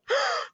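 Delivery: high, sharp intake of breath; startled high gasp; shocked gasp. A narrator's reading. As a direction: gasp in horror. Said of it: "heard the gasp".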